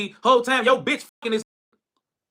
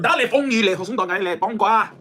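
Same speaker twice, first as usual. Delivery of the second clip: mock Chinese gibberish; 2 semitones lower than usual. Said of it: "Some languages be funny as hell".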